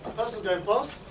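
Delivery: ordinary talk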